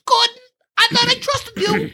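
very high, silly cop voice; hammed-up puppet voice scolding a colleague; Muppet-like captain. Host mocking the captain confronting Gordon, Muppet-like impression. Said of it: "he sounded like a Muppet".

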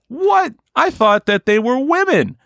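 a squeaky high shocked What?. Acted. [name] reacting to Jeffrey Epstein, high-pitched shock.

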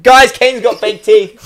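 put-on high, loud, slow kid's shout; telling the whole room. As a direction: yell it out like a child exposing a secret. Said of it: "Told the whole room".

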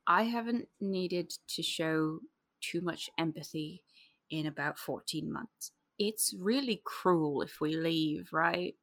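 low, slow, very soft; quiet, reluctant reckoning; subdued admission. Acted. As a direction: confess it haltingly, voice dropped. Character reluctantly admits feeling.

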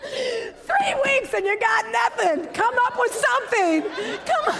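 high, laughing, slow then pushing; laughing mock scold. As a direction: scold yourself through laughter.

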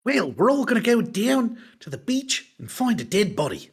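lightly voiced Aussie, playing it up; Australian accent. Friends joking about a crime show, mock Australian accent. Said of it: "my Australian accent needs work".

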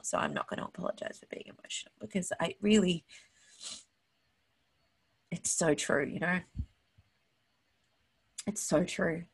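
real tears, voice dropping to a whisper; fighting back tears, barely voiced; choked up. Real, not acted. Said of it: "I'm sorry that I'm so emotional".

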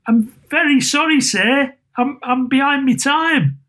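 high, stammering apology; nervous clerk. A narrator's reading. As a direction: plead timidly with the boss.

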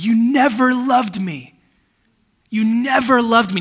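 retelling a man's pained shout, slow; imitating a yell. Preacher retells a man yelling into his phone, mock yell. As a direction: shout the stranger's accusation, drawn out. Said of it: "he's yelling into the phone".

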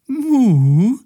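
very high, loud gleeful cow moo; joyful moo. A narrator's reading. The cow Carola splashes Mathilda, a gleeful high moo. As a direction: moo happily while splashing. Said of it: "freute sich Carola".

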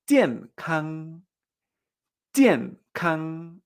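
"jiankang" with a sharp fall, then a sustained even syllable; falling then level tones. Shown as a demonstration. Teacher modelling health, "jiankang" falling then level. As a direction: drop on "jian", hold "kang" steady. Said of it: "son con la misma entonación".

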